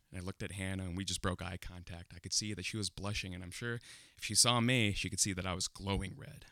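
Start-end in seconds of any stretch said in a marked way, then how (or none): none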